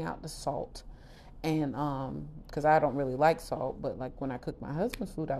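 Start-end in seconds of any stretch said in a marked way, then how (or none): none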